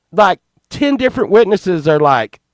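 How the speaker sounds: normal talking voice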